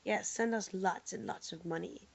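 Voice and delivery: ordinary talk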